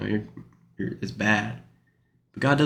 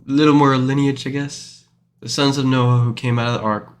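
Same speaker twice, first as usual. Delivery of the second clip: normal talking voice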